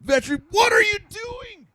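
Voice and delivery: very high, crying shriek of what are you doing; shrieking vet. Acted. Vet screaming at the farmer in a very high horrified wail. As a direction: wail in horror, voice cracking high.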